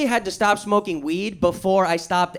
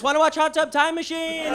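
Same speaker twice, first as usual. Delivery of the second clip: high, soft, lightly voiced stoner; a hint of a hopeful stoner friend; stoner buddy; 5 semitones higher, 3 dB quieter than usual. Buddy calling after him, lightly voiced hopeful stoner. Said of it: "the last thing I heard was".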